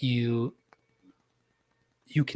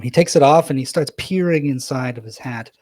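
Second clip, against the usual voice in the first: ordinary talk, nothing marked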